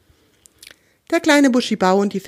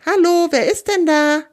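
high-pitched storybook hello into the dark; pitched-up, searching call; louder call; 9 semitones higher, 4 dB louder than usual. A narrator's reading. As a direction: call out to unknown figures, voice raised. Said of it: "Etwas lauter ruft er dann".

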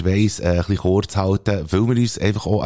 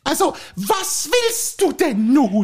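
a higher, fussy housewife voice pestering her husband; nagging wife; slower than usual. Acted. Wife nagging her husband to go for a walk, fussy. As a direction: play the nagging wife in a comic sketch.

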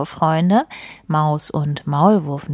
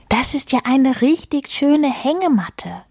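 higher-pitched, lightly excited call; admiring exclamation; 8 semitones higher than usual. Acted. Mole admiring mouse's hammock, lightly excited exclamation. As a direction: exclaim with mild delight. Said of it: "rief Maulwurf".

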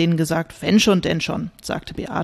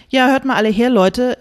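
higher, louder call for attention; calling everyone; 6 semitones higher, 4 dB louder than usual. Acted. [name] quieting the living-room group, louder and higher. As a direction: raise your voice over the chatter. Host calls the group to order.

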